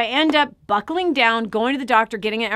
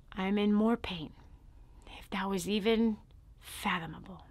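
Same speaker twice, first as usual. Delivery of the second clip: hushed retelling; 12 dB quieter than usual. Real, not acted. Recalling waking paralyzed to the audience, softly hushed. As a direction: hold the room in a hushed, grave voice.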